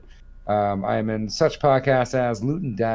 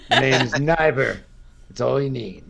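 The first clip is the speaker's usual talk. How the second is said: mock movie tagline; 2 semitones higher than usual. Tagline for the made-up name.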